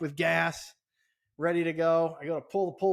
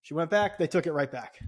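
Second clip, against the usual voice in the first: usual speaking voice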